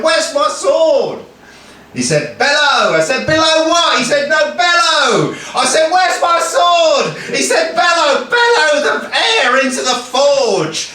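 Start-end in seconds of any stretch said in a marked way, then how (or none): 0.0-1.2 higher, drawn-out mock outrage; indignant demand. Achilles to swordsmith over missing sword, indignant demand. 2.4-10.9 high-pitched, projected comic shouting match; bellowed argument. Acted. Swordsmith and Achilles at cross purposes, bellowed argument.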